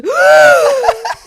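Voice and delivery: big, loud, high mock gasp; hammed-up gasp of surprise; imitated shocked gasp. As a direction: gasp dramatically, imitating a stunned friend. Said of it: "Brandon just goes".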